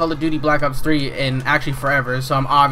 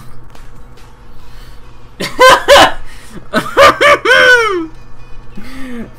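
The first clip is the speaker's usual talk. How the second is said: hysterical high laugh; 6 dB louder than usual. Real, not acted. After outburst.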